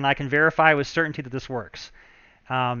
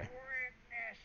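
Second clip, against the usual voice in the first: creepy acolyte voice; 6 semitones higher, 19 dB quieter, much slower than usual. Acted. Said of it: "let's just get it done".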